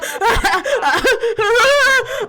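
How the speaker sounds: loud, high theatrical laugh; big put-on character laugh; Vax. Shown as a demonstration. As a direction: cackle loudly to find the character. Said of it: "When I wanna be Vax, I have to go".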